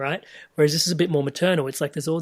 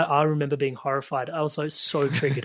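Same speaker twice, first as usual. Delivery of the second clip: usual speaking voice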